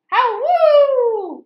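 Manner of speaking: long high loud howl; dog howl. Acted. Reading to kids, the dog howling loud and high. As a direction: howl like a hound.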